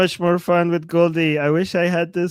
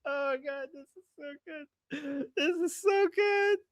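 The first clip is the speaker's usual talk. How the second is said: high, very soft squeal; overcome with delight; 8 semitones higher, 10 dB quieter than usual. Real, not acted. Streamer overcome after laughing at a clip, delighted. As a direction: gush softly in a high, spent voice.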